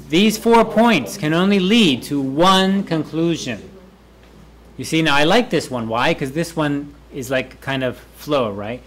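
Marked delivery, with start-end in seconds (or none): 0.1-3.7 "four", "lead" and "one" each stressed; emphatic model sentence. Shown as a demonstration. Teacher repeats the sentence for students, with emphatic stresses. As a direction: punch "four" and "one", falling on "conclusion".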